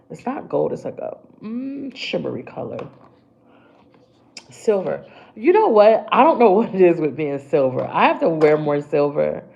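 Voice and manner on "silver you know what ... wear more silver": much louder, animated rant with a laugh; excited. Real, not acted. Telling viewers about her silver obsession, loud excitement. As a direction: gush about silver with rising energy.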